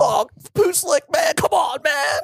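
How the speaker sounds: high, drawn-out frustrated yell; exasperated shout. Real, not acted. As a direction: groan at a missed chance. Groaning about the player.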